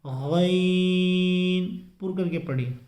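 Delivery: गैन drawn out slowly, heavy and rounded; full-mouthed ghain. Shown as a demonstration. Teacher to viewers, a slow heavy गैन. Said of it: "गैन को पुर करके पढ़ना है".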